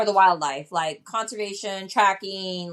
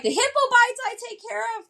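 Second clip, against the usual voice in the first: doctor bragging; 13 semitones higher, much faster than usual. Retold or imitated. Doctor boasting about hippo bites.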